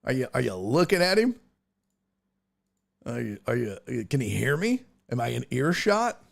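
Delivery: ordinary talk, nothing marked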